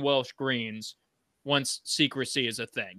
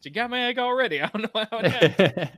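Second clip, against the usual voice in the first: high mock-bewildered dragon voice, getting louder; baffled dragon; 8 semitones higher, 4 dB louder, faster than usual. Dragon explaining the stolen egg already gone, comically baffled. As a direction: protest in comic disbelief, pitched well up. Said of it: "the dragon's just like".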